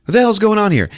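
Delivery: high, loud incredulous question; imitating a bewildered onlooker raising the voice; alarmed demand. Alarmed onlookers confront possessed wife.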